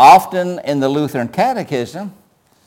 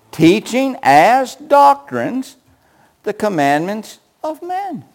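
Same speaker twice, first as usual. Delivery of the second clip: slow, higher, doctrines drawn out; hammered repeat; 7 semitones higher, slower than usual. Shown as a demonstration. Preacher driving the verse home, slow. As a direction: repeat it, leaning hard on doctrines. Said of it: "repeated for emphasis".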